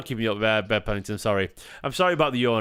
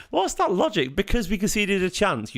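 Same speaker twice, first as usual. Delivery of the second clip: incredulous disbelief; 8 semitones higher than usual. Real, not acted. Arguing with viewer in chat.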